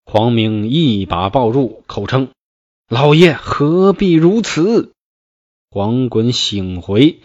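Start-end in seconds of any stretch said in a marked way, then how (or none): 2.9-4.9 pleading retainer. Acted. Huang Ming restrains the old general.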